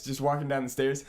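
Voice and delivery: ordinary delivery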